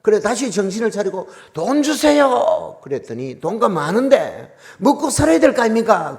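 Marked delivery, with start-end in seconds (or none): 1.5-2.8 slow, high, loud plea for money; loud begging. Retold or imitated. Pastor pleading with God for money, loud and drawn out. 3.4-6.2 higher, louder demanding complaint; loud protest. Retold or imitated. Pastor arguing with God about food, loud protest.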